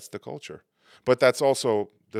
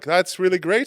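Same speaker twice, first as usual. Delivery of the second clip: high, loud, falsely cheerful praise; passive-aggressive niceness; 9 semitones higher, 10 dB louder than usual. Acted. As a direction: hide anger under mock delight. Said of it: "passive aggressive, what I mean".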